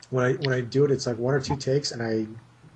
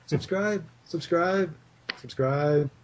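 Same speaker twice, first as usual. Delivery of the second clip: higher mock nagging chant that slowly settles down; Stewie-style nagging; 5 semitones higher, much slower than usual. Guest turns the Stewie nag into a subscribe plea, mock nagging. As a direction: pester them like the cartoon baby, easing off. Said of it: "just change it up to subscribe".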